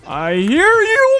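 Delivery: high, slow, sing-song taunt; high drawn-out singsong answer to the noise; mock spooky singsong. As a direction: croon it back like a creepy echo. Creepy sound heard, taunting back.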